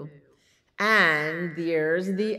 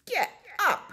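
high, yappy two-word command; woofing dog. A narrator's reading. Dog chasing the cat off, high woof. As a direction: woof it out like a small yap. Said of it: "woofed dog".

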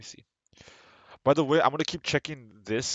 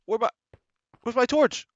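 surprised; 12 semitones higher, 6 dB louder than usual. Real, not acted. Confused searching for torch in game.

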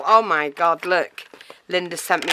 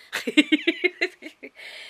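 high-pitched giggle; delighted laugh; 12 semitones higher than usual. Real, not acted. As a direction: burst into a high, giddy laugh. Overwhelmed by the birthday parcel.

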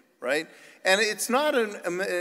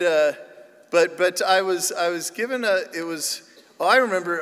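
usual speaking voice

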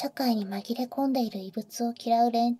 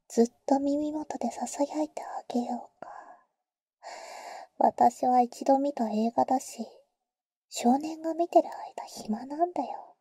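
ear whisper. Acted. Offering to whisper in his ear through the movie, whisper. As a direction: murmur it as if lips touch his ear.

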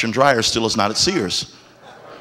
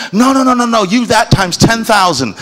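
put-on child voice, gushing; 10 semitones higher than usual. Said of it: "child flattering dad".

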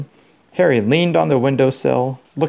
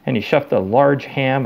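plain reading voice

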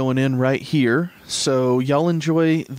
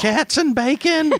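incredulous; 13 semitones higher, slower than usual. Real, not acted. Incredulous high echo.

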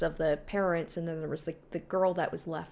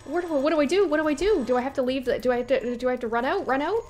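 high, loud frantic questions; real panic; 7 semitones higher, 7 dB louder, faster than usual. Real, not acted. Player unsure how to escape, asking in panic. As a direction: fire off questions in a raised, panicked voice. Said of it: "I'm panicking".

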